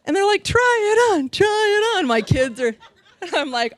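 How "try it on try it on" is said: high, eager family chant; imitated urging. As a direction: egg someone on in a high voice.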